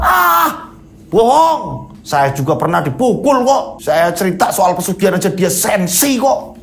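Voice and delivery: very high, scornful voice; scoffing Haji Imron. Acted. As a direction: scoff like a sore loser. Haji Imron refuses to accept.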